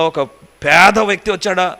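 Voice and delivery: ordinary delivery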